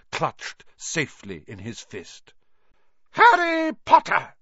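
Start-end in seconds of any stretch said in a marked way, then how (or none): 3.1-4.4 very high, loud shout; outraged shout. A narrator's reading. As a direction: shout the pupil's name in shock. Professor running up to a pupil.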